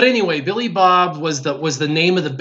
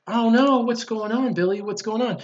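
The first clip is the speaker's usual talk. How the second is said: concerned mom; 5 semitones higher than usual. Retold or imitated. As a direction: imitate a mother comforting her upset child. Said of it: "and she was like".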